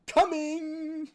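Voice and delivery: very high, loud sing-song call; creepy eager call. Retold or imitated. Character dashing at the player calls out very high and loud. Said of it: "he literally goes".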